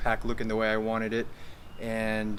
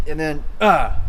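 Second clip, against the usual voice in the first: releasing breath; 7 dB louder than usual. Shown as a demonstration. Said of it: "no more air, zip up your abs".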